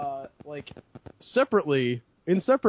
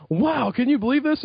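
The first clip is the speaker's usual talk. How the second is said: mildly hammed-up wonder, higher pitch; amazed; 6 semitones higher, 3 dB louder than usual. Player retelling his excitement over a tablet game, amazed. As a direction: retell your own wide-eyed amazement. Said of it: "being amazed by things".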